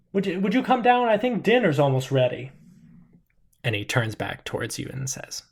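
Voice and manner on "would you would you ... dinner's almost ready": calling upstairs. Acted. Dad calls daughter upstairs.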